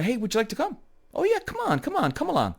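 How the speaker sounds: high-pitched, quick, welcoming demo; eager invitation. Shown as a demonstration. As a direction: coax them along in a high, light voice. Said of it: "you wanna ask the girl out".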